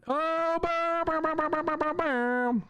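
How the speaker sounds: high sing-song bam bam; mock bam noises. Streamer playing with chat, mock bam sounds. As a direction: bang it out like a cartoon.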